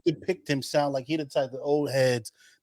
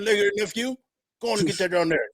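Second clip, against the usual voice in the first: a hint of cigarette-in-mouth old man, a bit higher; old head with cigarette; 6 semitones higher than usual. Old head telling nephew to eat, lightly voiced cigarette talk. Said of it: "They talk like this".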